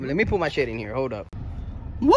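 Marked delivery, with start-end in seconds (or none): 2.0-2.1 long, excited "woo"; celebratory whoop. Real, not acted. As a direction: let out a drawn-out whoop. Celebrating the haul.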